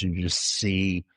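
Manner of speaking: ordinary talk, nothing marked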